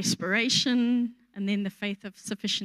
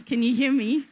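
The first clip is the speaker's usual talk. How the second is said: raised voice to carry over the room; shouting; 4 dB louder than usual. Real, not acted. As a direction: call it out to the back row. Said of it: "I'm having to shout now".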